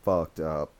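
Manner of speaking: dread. Retold or imitated. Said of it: "I'm like".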